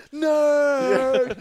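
mock outraged no; laughing shriek; high laughing yell. Real, not acted. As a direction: squeal no through laughter. Laughing exaggerated denial.

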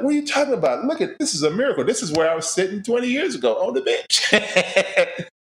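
overwhelmed by a miracle offer, pitch soaring; thrilled incredulous exclaim; amazed disbelief. Retold or imitated. Retold disbelief at NBA job offer.

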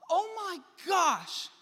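high, loud, slow horrified gasp; shocked teacher gasp. Retold or imitated. Preacher mimics the shocked teacher seeing his finger, loud and slow. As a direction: play the appalled teacher, drawn out and high. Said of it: "She's like".

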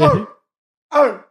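high, half-hearted raptor screech; raptor noise. Pretend. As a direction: try a raptor call. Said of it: "That's me trying to do the raptor noise".

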